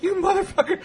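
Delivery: put-on outraged kid betrayed by uncle; squealed laughing insult; laughing outrage. Kid realizes gun was empty.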